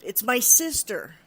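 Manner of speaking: loud, slow retold yell; shouted outburst, every word stretched. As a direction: burst out with a long-held confession. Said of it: "he finally yelled out".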